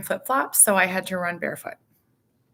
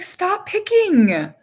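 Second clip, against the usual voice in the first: high, loud, drawn-out plea; pleading mom, slow and high; retold exasperated mom; 13 semitones higher, 7 dB louder, much slower than usual. Mom retells telling son to stop.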